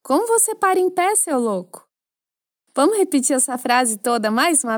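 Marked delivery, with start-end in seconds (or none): 0.0-1.9 higher, fast disbelieving jab; playful shocked question; incredulous. Pretend. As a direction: tease him like he's crazy. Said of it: "se fosse eu, já perguntaria".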